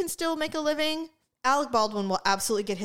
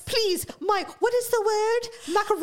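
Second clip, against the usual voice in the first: Hilaria Baldwin fake accent; 9 semitones higher than usual. Mocking a celebrity who pretends to forget English, laughing fake Spanish accent. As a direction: parody someone faking a Spanish accent, grasping for English words. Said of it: "She's like".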